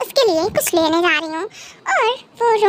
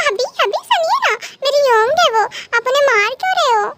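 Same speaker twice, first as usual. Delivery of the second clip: lightly voiced, higher-pitched pleading wife; pleading young wife; 6 semitones higher than usual. Cookie begs her husband to stop hitting, lightly voiced pleading.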